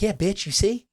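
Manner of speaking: high, loud triumphant retort; mock gloating. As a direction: crow it like an I-told-you-so. Retells gloating reply to wife.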